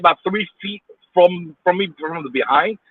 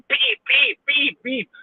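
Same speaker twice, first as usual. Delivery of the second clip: high-pitched imitated car horn; mocking beeps; 10 semitones higher, much slower than usual. Driver mimicking the honkers, mocking high beeps. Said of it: "Usually it's one of those assholes".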